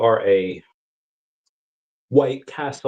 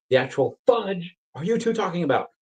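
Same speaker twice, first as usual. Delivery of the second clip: higher, strained exasperated voice; mock exasperation; 8 semitones higher than usual. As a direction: act baffled and fed up. Exasperated retold quote.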